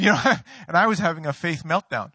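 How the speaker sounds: normal talking voice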